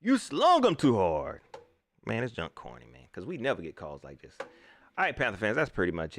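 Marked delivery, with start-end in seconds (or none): 0.0-1.4 slow mock ref. Real, not acted. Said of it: "repeated for emphasis".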